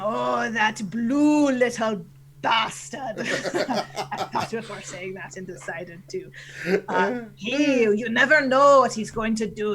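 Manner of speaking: theatrical pirate captain voice; Canadian pirate. Acted. Explaining the rival team to listeners, Canadian pirate voice. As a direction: puzzle it out like a comic pirate. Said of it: "your Canadian pirate voice".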